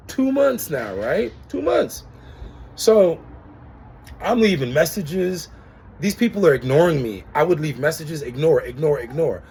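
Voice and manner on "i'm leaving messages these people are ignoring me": usual speaking voice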